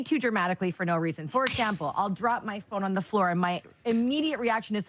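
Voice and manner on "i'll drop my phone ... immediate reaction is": ordinary delivery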